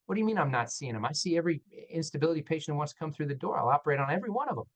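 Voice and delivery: fast, indignant protest; a surgeon insisting he sees every patient, fast; defensive rush. Retold or imitated. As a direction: rattle it off in self-defense. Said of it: "so I'm like".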